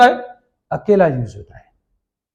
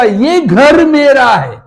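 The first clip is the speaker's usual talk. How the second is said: slow example; 3 semitones higher, much slower than usual. Shown as a demonstration. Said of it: "तो मैं क्या बोलूंगा".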